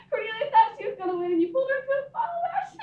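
high, fast laughing words; bubbling over with glee; gleeful about Cornelia in the ashes; giggling. Real, not acted. Giggling at sister's fall.

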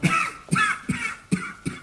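high wheezing cough fit; asthma cough. Acted. Commissaire wheezing, asthma fit.

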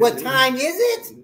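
What time is it said high through a laugh; mock correction laughing; corrected, laughing. Shown as a demonstration. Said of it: "I said".

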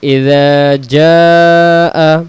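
very slow melodic ইযা জাআ; recited slowly. Shown as a demonstration. Teacher reciting the correct reading, slowly chanted. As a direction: draw the words out like recitation. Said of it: "তো আমরা পড়ি".

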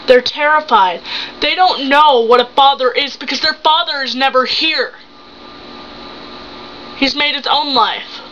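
acted marital showdown, hurt and indignant; betrayed wife, accusing. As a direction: confront the cheating husband, stressing each accusation. Acts a monologue as betrayed wife.

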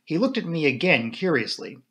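plain reading voice

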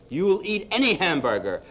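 ordinary talk

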